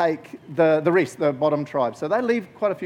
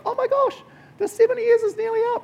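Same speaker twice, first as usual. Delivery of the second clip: very high squeal of mock excitement; Daniel all excited; 3 dB louder than usual. Daniel realizes the seventy years are nearly up, excited squeal. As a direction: squeal it out, thrilled by the discovery. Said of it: "he's all excited".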